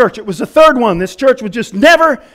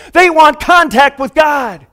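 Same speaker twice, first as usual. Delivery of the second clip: impassioned declaration; 5 semitones higher than usual. Acted. Preacher's rising climax.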